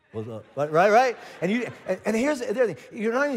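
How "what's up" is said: low, soft, too-cool greeting; mock teenage cool, low and quiet; cool cruiser. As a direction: play it cool, dropped way down. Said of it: "remember how cool you were".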